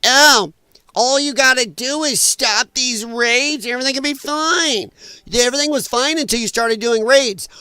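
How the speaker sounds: parroting the politicians with contempt; mocking politician. Ranting host mocks the Mayor and Newsom, sneering high voice. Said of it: "making statements saying".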